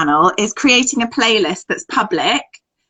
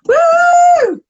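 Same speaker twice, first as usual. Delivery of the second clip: high cheering woo; giggly late night call; 6 dB louder than usual. Real, not acted. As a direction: whoop it out loud. Cheering goodbye.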